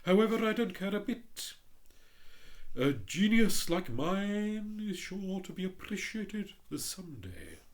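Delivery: hint of a haughty rocket; lightly voiced pompous Rocket. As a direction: read it as a vain firework showing off. The Rocket boasting, louder.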